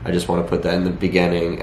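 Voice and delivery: normal talking voice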